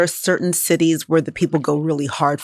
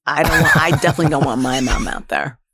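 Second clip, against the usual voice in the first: ordinary talk, nothing marked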